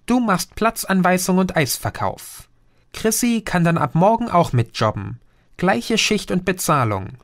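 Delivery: a busy mom firing off instructions; bustling mother. Acted. Mother briefing son at breakfast. (geschäftige Mutter, hoch und flott)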